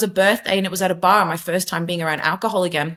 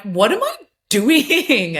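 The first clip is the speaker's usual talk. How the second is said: slow, raised, disbelieving question; bewildered self-question; 5 semitones higher, slower than usual. Retold or imitated. Retelling a high night, asking herself in slow disbelief. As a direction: ask yourself in stunned disbelief. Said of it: "and I'm like".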